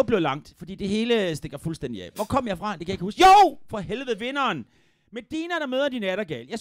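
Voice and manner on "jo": loud, high yelp; sudden recollection. Real, not acted. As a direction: burst out as it comes back to you. Suddenly remembers lost thread.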